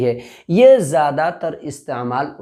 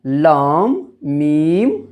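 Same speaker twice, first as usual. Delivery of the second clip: reciting letter names; 2 semitones lower, much slower than usual. Shown as a demonstration. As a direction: call out each letter for the class to repeat. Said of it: "उसके बाद क्या है?".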